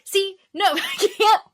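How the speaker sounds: high laughing exclaim. Real, not acted. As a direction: laugh through the words. Laughing at own mistake.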